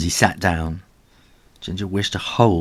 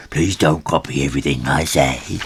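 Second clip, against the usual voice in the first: low mocking echo; low copycat; 5 semitones lower than usual. Other twin copies Ginger, low mock echo. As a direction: parrot him back in a lower voice.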